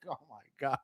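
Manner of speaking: very soft disbelieving oh my God; hushed stunned aside; stunned whisper. Retold or imitated. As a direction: breathe it out in disbelief. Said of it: "I was like".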